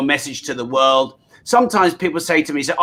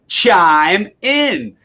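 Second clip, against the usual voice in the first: mock American accent; 3 semitones higher, 5 dB louder than usual. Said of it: "as you say in America".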